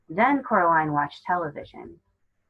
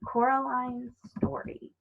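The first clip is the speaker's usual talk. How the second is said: storybook title; 6 semitones higher than usual. A narrator's reading. Reading a child's story title.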